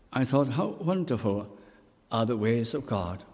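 ordinary talk, nothing marked

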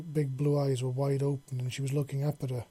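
usual speaking voice

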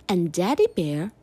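ordinary delivery, nothing marked

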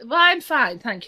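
prissy polite brush-off; very British politeness. Shown as a demonstration. Turning down peanuts in a Boston bar, primly British. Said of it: "And this very British".

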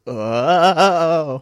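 long, loud, high "oh"; startled cry. Retold or imitated. Retelling a scare as he turns round, startled yell. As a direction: draw out a loud, rising cry of alarm. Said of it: "turns around and goes, like".

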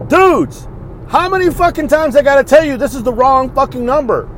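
loud, high-pitched telling-off; fed-up yell at absent callers; exasperated outburst. Acted. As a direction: scold them loudly, at the end of your patience. Addressing wrong-number texters in exasperation.

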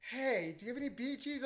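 mildly mock a drunk song request; drunk partygoer. Drunk woman asking the DJ for songs, lightly imitated drunk. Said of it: "She was fucking drunk".

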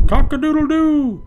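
high, loud crowing rooster. Pretend. Reading to kids, crowing loudly like a rooster. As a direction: crow it out like a rooster.

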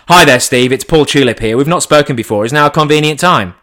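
loud, brisk headhunter greeting; full sales pitch. Acted. Recruiter rings a roofer, full sales pitch. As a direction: project a polished cold-call opener. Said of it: "full sales pitch, full headhunt call".

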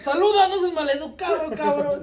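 high, laughing mock scold; scolding parent voice.

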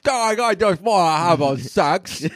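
a creepy Love Actually Brit; British perv accent. Retold or imitated. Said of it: "Love Actually perv British accent".

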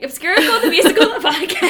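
loud, laughing title reveal; excited announcement. Real, not acted. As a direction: shout the name with a laugh.

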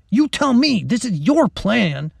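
high, irritated comeback; exasperated schoolboy retort; snapped. A narrator's reading. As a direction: throw the blame back, drawing out the end. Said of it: "he snapped".

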